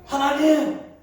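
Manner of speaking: Jonah sulking at God, voice pushed up; whiny angry protest; peevish complaint. Acted. Jonah complaining to God about Nineveh, angry. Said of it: "요나가 하나님께 성질을 부립니다".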